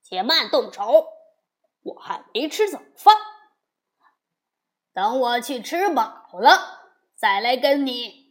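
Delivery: loud, in a rush at first; loud halt. Acted. Demon king stopping the fight, shouting loud. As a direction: call a halt in the fight, loudly. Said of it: "说".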